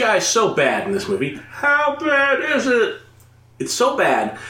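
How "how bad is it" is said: very high, drawn-out set-up question; mock audience heckle. Comedian playing own audience before the punchline, mock-eager. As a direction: chant it slowly like a crowd feeding a comic. Mimics audience feeding joke setup.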